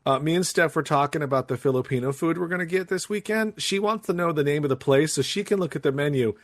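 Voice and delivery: ordinary talk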